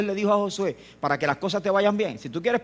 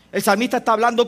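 normal talking voice

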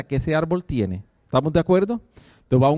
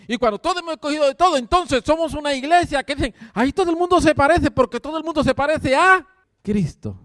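rising preaching fervor; 7 semitones higher than usual. Acted. Preaching builds to climax.

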